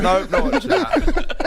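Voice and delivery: high, laughing mock refusal, slowed. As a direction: laugh it off and turn away from the screen. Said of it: "I went".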